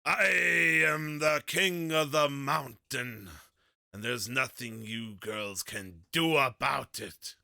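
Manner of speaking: loud, drawn-out battle cry; boastful shout, stretched out slowly. Acted. Player charging into the tunnel in character, shouting.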